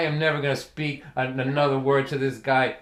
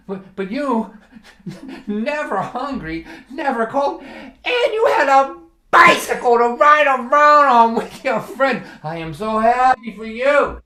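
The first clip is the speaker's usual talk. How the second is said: high, loud and sarcastic, laughing through it; mocking dad; 10 semitones higher, 9 dB louder, slower than usual. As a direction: lay the fake joy on thick, half-laughing. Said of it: "He goes".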